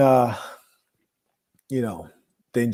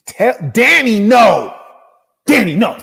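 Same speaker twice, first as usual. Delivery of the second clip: yelling inmate; 7 semitones higher, 7 dB louder than usual. Retold or imitated. Inmate voiced yelling at nobody.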